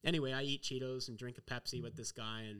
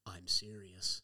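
low, grave and flat-out earnest; dead serious; 6 semitones lower, much slower than usual. Retold or imitated. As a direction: say it lower, like a stern warning. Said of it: "this guy is dead serious".

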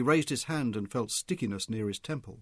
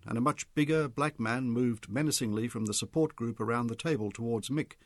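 usual speaking voice

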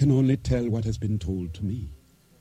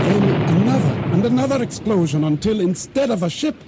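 higher, urgent storytelling as the wave hits; breathless climax; 7 semitones higher than usual. Acted. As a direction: build the panic of being swept under. Narrator's climax of explosion and drowning.

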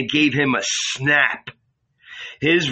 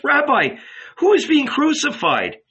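high, louder, alarmed question; frightened plea; 3 dB louder than usual. A narrator's reading. John clinging to Jesus, crying out high and afraid. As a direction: beg to know, in fright. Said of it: "falling on Jesus' breast".

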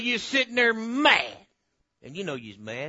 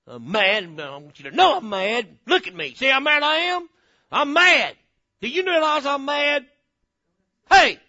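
loud, hammed-up angry outburst; mad; 4 semitones higher, 7 dB louder than usual. Preacher acting out a churchgoer's grudge, mock mad. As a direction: play mad and demand to be noticed. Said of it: "had that mad look".

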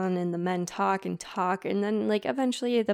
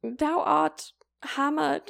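high, slow, louder hammed-up archaic proclamation; mock old English; 7 semitones higher, 4 dB louder, slower than usual. Teller mocking Ichabod's drunk proposal, high slow mock archaic voice. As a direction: declare it like a pompous bard.

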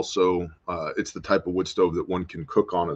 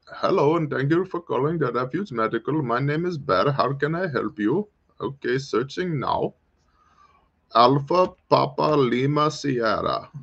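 higher, put-on half German, half Indian accent as a phone agent; mock Indian call-centre accent; 6 semitones higher than usual. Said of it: "half Indian accent needs work".

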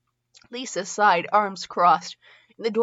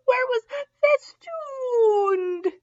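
very high, long-stretched cry; hammed-up wailing; much slower than usual. Real, not acted. Reader finding the beloved word, hammed-up wail.

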